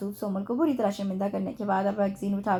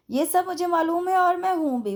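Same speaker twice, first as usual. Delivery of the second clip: a pulled face, higher pitch, smug; 8 semitones higher, 3 dB louder than usual. A narrator's reading. Guest to secretary, smug with a pulled face. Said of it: "वो मुँह बनाकर बोला".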